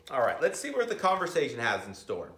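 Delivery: plain reading voice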